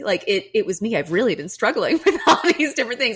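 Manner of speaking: owning up while laughing; sheepish laughing admission; laughing confession. Retold or imitated. Retold confession to trainer.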